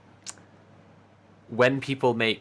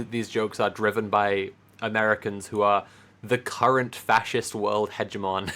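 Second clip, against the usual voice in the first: ordinary talk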